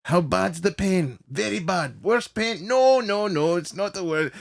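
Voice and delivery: high, cartoonish question and answer; Looney Tunes dialogue. Comedian acting out readings on the pain dial, cartoonish impression. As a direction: act out a cartoon exchange, pitch climbing. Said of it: "like a Looney Tunes thing".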